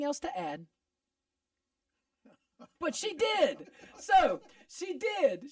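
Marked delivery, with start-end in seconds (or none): 2.8-5.5 emphatic. Real, not acted. Emphatic rebuttal on the show.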